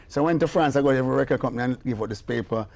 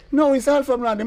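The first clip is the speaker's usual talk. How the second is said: high, louder firm correction; insisting; 13 semitones higher, 6 dB louder than usual. Retold or imitated. The lady insists to Clive, high and firm. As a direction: set him straight. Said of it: "She say".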